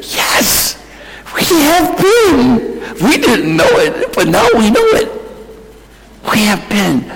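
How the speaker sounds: high, choked-up tearful cry; weepy. Real, not acted. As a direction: answer through real tears, voice breaking upward. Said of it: "I seem a little weepy".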